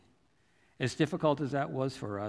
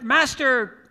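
high, loud call across a valley; shouted; 11 semitones higher, 9 dB louder than usual. Retold or imitated. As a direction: call out to a distant king. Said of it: "And he shouted".

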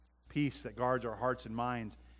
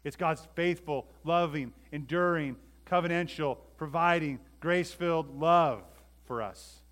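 loud, high, word-by-word build; impassioned preaching; 5 semitones higher, 9 dB louder than usual. Real, not acted. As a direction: hammer out each quality of that love.

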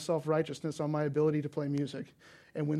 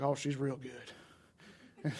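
slow, soft Southern Indiana bartender drawl; lazy Southern Indiana drawl, softer; 2 semitones lower, 5 dB quieter, much slower than usual. Retold or imitated. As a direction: stretch it out like a southern Indiana barman. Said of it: "there's a little bit of a drawl".

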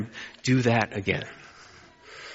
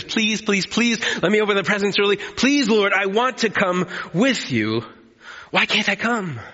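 much higher, fast whiny pleading; little kid begging; 5 semitones higher than usual. Acted. Child begging to open presents early, whiny pleading. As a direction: plead like a child on Christmas morning. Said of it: "a little kid on Christmas".

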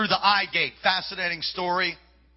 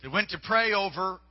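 ordinary talk

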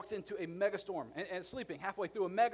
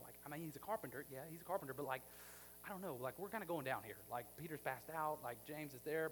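hesitant disciples; 3 semitones lower, 6 dB quieter, faster than usual. Retold or imitated. Timid disciples mocked.

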